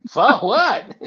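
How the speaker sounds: sudden high-pitched double take; high surprised disbelief. Retold or imitated. Retelling the radio story to a friend, high mock disbelief. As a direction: squeak out the shock at the word. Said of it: "And it's like".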